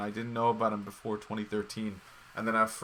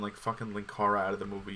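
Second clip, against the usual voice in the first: ordinary delivery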